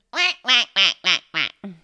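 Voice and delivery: loud nasal quacking; short, punchy duck calls. Shown as a demonstration. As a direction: quack like a duck, all in the nose. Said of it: "Could you please be a duck?".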